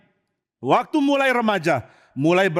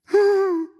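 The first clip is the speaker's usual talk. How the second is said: gasp; 13 semitones higher than usual. Acted. Tamar upset at the table.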